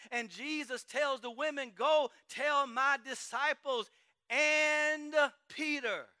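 loud, fervent preacher voicing the apostles; preaching at full force. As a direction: preach it, lifting the crowd with each testimony. Voices apostles in passionate preaching.

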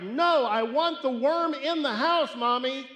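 high, loud whining tantrum; child tantrum. Acted. Little boy throwing a fit at his mom over the worm. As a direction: wail it like a bratty kid.

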